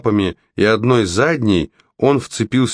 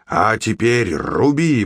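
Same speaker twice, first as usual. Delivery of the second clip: slow, drawn-out order; commanding shout; 2 semitones higher, slower than usual. A narrator's reading. Jack orders Red to chop now, shouting. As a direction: stretch the command like a distant call. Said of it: "прокричал Джек".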